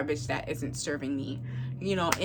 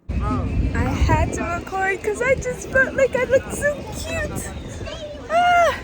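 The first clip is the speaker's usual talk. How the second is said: excited; 6 dB louder, slower than usual. Real, not acted. Thrilled about looking cute.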